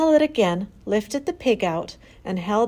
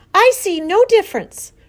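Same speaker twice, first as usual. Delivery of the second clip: high, loud, defiant retort; indignant protest; 12 semitones higher, 9 dB louder than usual. A narrator's reading. Daughter clinging to the axe argues with father, indignant. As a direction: refuse to back down, voice raised.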